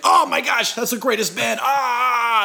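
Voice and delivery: high, loud, gushing imitation of a thrilled fan; fans freaking out. Imitating Russian fans who finally recognize the band, hammed-up squeal. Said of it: "And they freak out".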